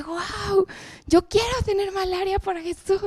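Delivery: high, soft, starry-eyed gasp of wonder; awed teen girl. Retold or imitated. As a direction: replay your young self dazzled by the idea. Retelling her awed teenage reaction.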